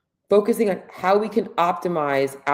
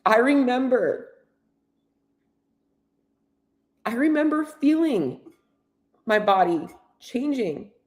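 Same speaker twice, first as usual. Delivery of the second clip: higher, halting, holding back real tears; choked up; 6 semitones higher than usual. Real, not acted. Coach recalling her thirties to viewers, voice choked up. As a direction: push the memory out through the lump in the throat. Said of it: "I'm sorry that I'm emotional".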